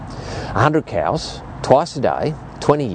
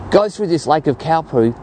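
ordinary delivery

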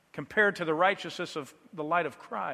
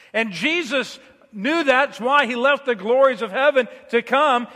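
louder, driving sermon delivery, building intensity; fervent preaching; 6 semitones higher, 6 dB louder than usual. Acted. Pastor urging his church, preaching at full force. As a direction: preach it hard to the congregation.

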